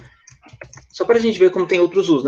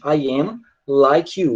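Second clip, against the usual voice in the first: "I am like you" slow and spaced; slow example sentence; much slower than usual. Shown as a demonstration. Teacher giving students a slow example. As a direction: say it slowly as a model. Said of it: "Quando eu digo assim".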